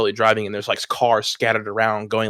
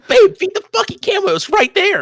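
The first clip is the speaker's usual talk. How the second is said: much higher, louder exasperated retold yell; exasperated mock yell; 4 dB louder than usual. Retelling how he yelled at his girlfriend to feed the camel, a high exasperated yell. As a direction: shriek it like you've lost all patience with her.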